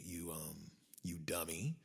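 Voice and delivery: dropped to a hushed aside; whispered you dummy; whispered insult. Retold or imitated. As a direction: slip the insult in under your breath. Insult under breath in retelling.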